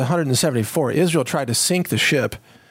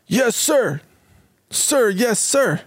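mock eager sailor; 6 semitones higher, much slower than usual.

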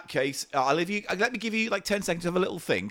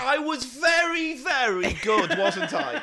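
egotistical rapper; 11 semitones higher, slower than usual. Acted. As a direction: gloat like a cocky rap star. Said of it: "acting like a big egotistical rapper".

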